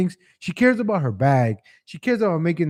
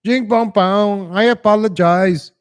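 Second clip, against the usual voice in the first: slow, higher put-on gibberish voice; John Cena mock voice; 5 semitones higher, 4 dB louder, slower than usual. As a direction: ham it up in a silly put-on voice. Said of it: "John Cena talks like that".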